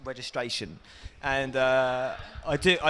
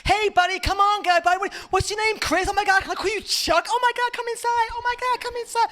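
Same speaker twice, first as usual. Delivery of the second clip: much higher, over-the-top eager trainer voice; enthusiastic gym guy. Retold or imitated. Gym guy welcoming a new member, high over-eager gushing. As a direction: gush like a gym salesman who loves everyone. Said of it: "so enthusiastic".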